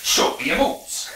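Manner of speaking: slow, gruff announcer growl; Captain Falcon voice. Acted. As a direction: growl it like a game hero. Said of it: "I can do a Captain Falcon".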